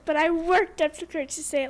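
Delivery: ordinary delivery, nothing marked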